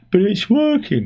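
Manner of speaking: high put-on voice of a help desk; mock support agent. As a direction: squeak it like a smug help desk. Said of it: "and they go".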